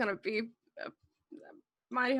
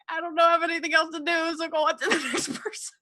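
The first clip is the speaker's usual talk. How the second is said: laughing; 7 semitones higher, faster than usual. Acted. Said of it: "I'm like".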